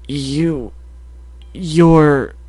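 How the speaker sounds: halting, broken-off words; stammering. Acted. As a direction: stammer it out with pauses between words. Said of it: "he stammered".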